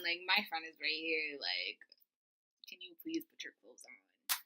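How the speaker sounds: low, hushed embarrassed plea; hushed pleading. Retold or imitated. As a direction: beg her quietly.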